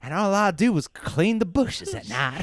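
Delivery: hammed-up Southern accent. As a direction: play an aggrieved backwoods brother with a Southern accent. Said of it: "I sound like the pervert guy and the Tiger King".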